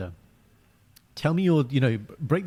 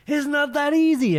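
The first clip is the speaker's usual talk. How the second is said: much higher, slow, put-on whiny girl; whiny girlfriend voice; 5 dB louder, slower than usual. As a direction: whine it like the indecisive girlfriend. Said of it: "And the girl's like".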